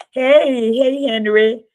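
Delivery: loud cheerful hello to Henry; calling out. Real, not acted. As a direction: hail a friend across the room. Greeting someone arriving.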